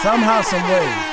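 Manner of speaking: loud, high, hammed-up outrage; mock outraged yell. Host yelling at his viewers in mock outrage. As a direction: bellow it like a mock preacher.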